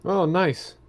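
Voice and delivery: normal talking voice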